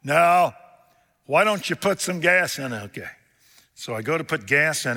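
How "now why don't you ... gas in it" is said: calling out. Retold or imitated. Father calling out instructions.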